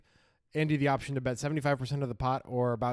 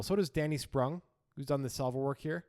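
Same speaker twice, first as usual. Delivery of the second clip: ordinary delivery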